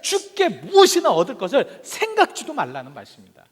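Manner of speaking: high, loud sermon delivery; forceful preaching. Real, not acted. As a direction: drive the warning home to the congregation. (힘주어 외치는 설교)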